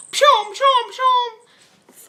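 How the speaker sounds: high, loud mock thump noises; imitated thumps. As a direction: bounce out each pshom like paws landing. Imitating cats thumping over her.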